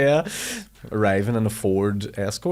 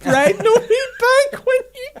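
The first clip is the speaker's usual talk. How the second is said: high, cracking with laughter; laughing; 6 dB louder than usual. Real, not acted. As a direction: squeal through the laugh. Laughing while teasing him.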